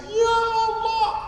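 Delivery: very high, slow imitated wail; hammed-up tearful cry to God; mock weeping. Pilgrims cry on seeing the Ka'bah, mock wailing. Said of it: "satu rombongan nangis".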